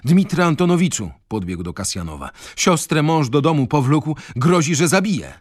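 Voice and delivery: urgent and high, as if just run in; out of breath. A narrator's reading. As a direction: blurt out urgent news, pitched high. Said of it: "ciężko dysząc".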